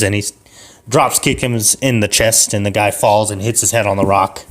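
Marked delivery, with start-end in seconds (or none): none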